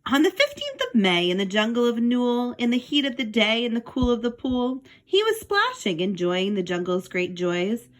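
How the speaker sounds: put-on lively read-aloud for children; storybook reading. Teacher reading a picture book to the class, lively storytelling.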